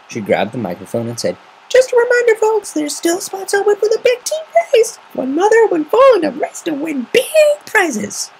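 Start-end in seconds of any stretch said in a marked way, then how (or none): 1.7-8.3 high, loud bubbly announcer voice; announcer call. Acted. Pinkie Pie announcing the race on the bandstand microphone, high and loud. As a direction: squeal it out over the mic.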